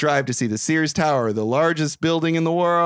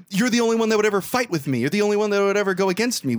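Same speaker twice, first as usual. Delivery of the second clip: higher, quicker mock pleading; pleading boss; 8 semitones higher, faster than usual. Boss begging Frank not to quit, urgent pleading. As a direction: plead hurriedly for him to stay.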